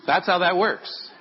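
normal talking voice

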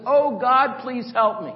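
loud, high desperate cry to God; mock-terrified yell for help; yelled plea. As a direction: shout it out in panic. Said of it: "the atheist yells out".

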